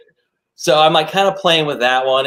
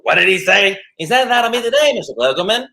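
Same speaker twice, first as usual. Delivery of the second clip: higher, lightly hammed-up Lovitz bluster; Jon Lovitz; 8 semitones higher than usual. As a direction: quote him with mock indignation. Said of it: "and John Lovitz".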